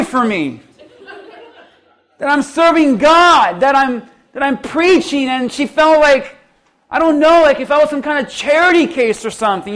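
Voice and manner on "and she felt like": ordinary delivery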